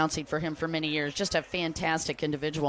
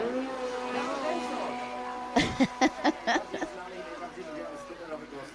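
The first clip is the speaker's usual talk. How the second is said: long, higher laugh trailing off softly; 5 semitones higher, 6 dB quieter than usual. Real, not acted. Announcer amused after greeting the fans, long laugh. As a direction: laugh on and on, letting it fade.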